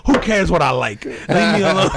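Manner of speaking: laughing mock retort. Storyteller joking back at rude French strangers, laughing. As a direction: toss it off while laughing.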